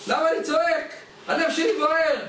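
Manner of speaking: high-pitched, worked-up retort; mock shouting, pitched well up; acted indignant shout. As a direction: play a man crying out in protest. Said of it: "למה אתה צועק".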